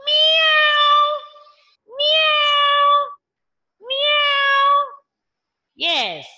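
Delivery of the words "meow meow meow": high mewing meow, louder; cat meow. Shown as a demonstration. Teacher meows like a cat, high and loud. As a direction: mew like a kitten calling. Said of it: "Yes, a cat".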